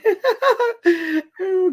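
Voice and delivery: high, loud burst of giggling; high giggle. Real, not acted. Amused at crunching being heard.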